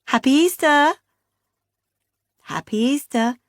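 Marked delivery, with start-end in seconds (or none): 0.1-0.9 high, loud cheery greeting; cheery Beth. A narrator's reading. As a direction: sing it out like a bubbly sister. Sister's cheery phone greeting.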